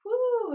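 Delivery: loud high whoop; excited whoop. Real, not acted. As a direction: shout a happy whoo. Cheering during the octopus movement.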